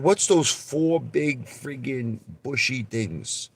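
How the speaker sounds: high-pitched, drawn-out leading question; imitated lawyer. Lawyer quizzing a witness about the view, imitated incredulity. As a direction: stretch it out as a mock courtroom quiz.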